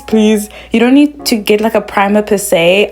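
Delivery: ordinary delivery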